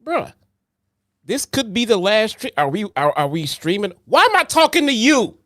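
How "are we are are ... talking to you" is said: ordinary talk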